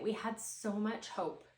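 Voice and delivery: ordinary talk, nothing marked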